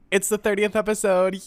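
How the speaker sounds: cheery milestone announcement; excited announcement. Real, not acted. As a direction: announce it with a lift.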